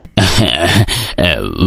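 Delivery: low, soft lecherous chuckle; low sly chuckle. Acted. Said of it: "मुखिया जोर से हंसता है". (धीमी कुटिल हंसी)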